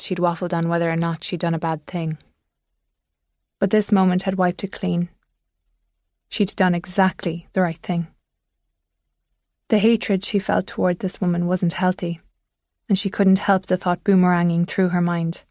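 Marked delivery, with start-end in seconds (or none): none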